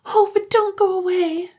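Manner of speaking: put-on high, loud child voice crying out; high childish cry; crying children. A narrator's reading. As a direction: plead like frightened little kids. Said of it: "they cried".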